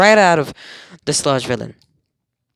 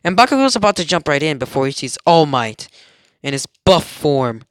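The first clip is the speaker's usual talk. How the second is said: ordinary delivery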